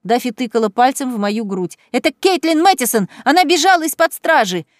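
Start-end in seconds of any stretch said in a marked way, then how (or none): 1.9-4.6 high, loud, rising frenzy; building, breathless accusation; trembling with excitement. Acted. Sister denouncing Kaitlyn to the officer, excited rising shout. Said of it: "её голос дрожал от возбуждения".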